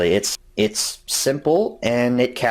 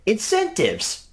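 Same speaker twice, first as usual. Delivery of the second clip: high drawn-out; 11 semitones higher, slower than usual. Pretend. Joking pun on a word.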